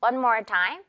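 ordinary delivery

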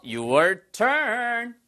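high, held call to the learners; drawn-out cue. Real, not acted. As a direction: sing out the cue, stretching the last word. Cueing learners to answer.

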